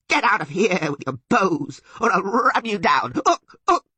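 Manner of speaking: high, loud, outraged creature voice; furious bleating Sylvan. A narrator's reading. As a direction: bleat angry threats, stretching key words. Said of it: "bleated even louder".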